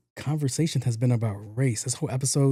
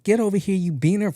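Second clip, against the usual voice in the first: mock yell; 6 semitones higher than usual. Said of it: "She's like".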